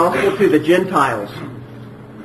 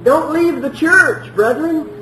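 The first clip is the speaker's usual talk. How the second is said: higher, slow, pleading "Don't leave the church"; mock preachy plea; 9 semitones higher, slower than usual. Shown as a demonstration. Preacher parodying a wrong sermon to the congregation, mock pleading. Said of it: "That's not what he preached".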